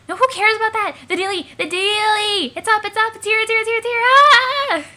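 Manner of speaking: very high, loud frenzy; ecstatic shrieking. Acted. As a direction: scream with uncontainable excitement. Character shrieks that mail came.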